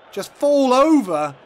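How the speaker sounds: high, loud and slow, dragged out in disbelief; exasperated yell. Real, not acted. Gamer yelling at his keeper, exasperated slow shout. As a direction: shout at the keeper who just fell over.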